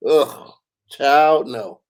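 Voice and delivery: loud groan, then a firm no; disgusted refusal. Real, not acted. Reacting to a co-host about lickable screens, disgusted refusal. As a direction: recoil in playful disgust.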